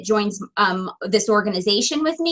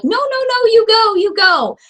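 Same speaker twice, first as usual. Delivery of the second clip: imitating restaurant staff; 9 semitones higher, 4 dB louder than usual. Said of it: "They're like".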